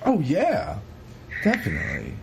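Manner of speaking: usual speaking voice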